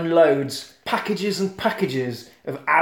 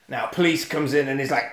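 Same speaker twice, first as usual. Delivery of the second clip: normal talking voice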